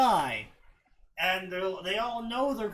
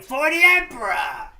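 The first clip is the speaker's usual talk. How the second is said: loud, higher accented war cry; accented battle-cry shout; 7 semitones higher, 11 dB louder than usual. Shown as a demonstration. Fan showing the meme to a friend, accented shout. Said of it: "people are shouting with an accent".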